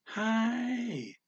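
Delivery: Hi pitched high and whiny. Shown as a demonstration. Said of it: "kind of high, whiny".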